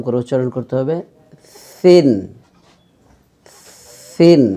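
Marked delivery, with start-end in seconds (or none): 1.8-4.4 সিন with soft th, tongue tip on upper teeth; lisped th starting সিন, drawn out. Shown as a demonstration. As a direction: say সিন as "thin", softly articulated. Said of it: "জিহ্বার আগা ... নরম".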